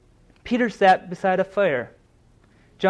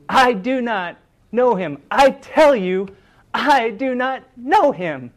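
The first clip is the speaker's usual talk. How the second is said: building louder and slower with each denial; emphatic denial; 4 semitones higher, 4 dB louder, slower than usual. Retold or imitated. Peter denying Jesus by the fire, insisting louder. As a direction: push the denial harder each time. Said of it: "emphatically denying knowing Jesus".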